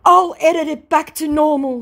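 high, loud mock promise; hammed-up pleading; imitating scammer.

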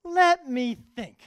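put-on high, slow little-kid musing; five-year-old voice. Judge imitating the boy pondering, high slow child voice. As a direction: play the thinking five-year-old, drawn out. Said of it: "puts his finger like this and says".

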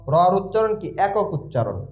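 normal talking voice

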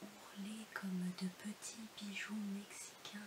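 whispered, very soft love poetry; hushed poem recitation. Acted. Reader praising the beloved's ears, whispered poem recitation. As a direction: drop to an intimate whisper for the beloved.

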